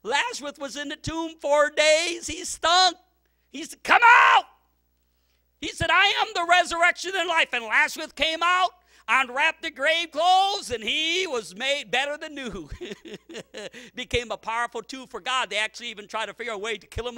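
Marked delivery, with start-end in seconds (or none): 0.0-3.8 rising, louder revival preaching; a pastor warming up the church; full force preaching. Acted. 3.8-4.4 very loud, high, ringing command; Jesus calling Lazarus from the grave. Acted. 5.6-12.7 rising, louder revival preaching; a pastor warming up the church; full force preaching. Acted.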